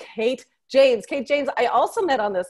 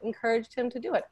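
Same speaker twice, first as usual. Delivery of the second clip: normal talking voice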